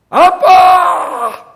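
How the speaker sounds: a long, very loud, high shout of 아빠; drawn-out shout. Shown as a demonstration. Pastor showing the wrong way to call on God, drawn-out shout. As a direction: stretch 아빠 into a huge high cry. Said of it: "기도할 때 하나님을 어떻게 부르라는 거예요?".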